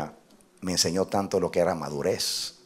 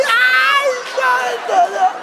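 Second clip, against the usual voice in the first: very high, loud shriek of despair; comic wail; 7 dB louder, much slower than usual. Retold or imitated. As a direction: cry out in hammed-up anguish. Comic despair over cut shoes.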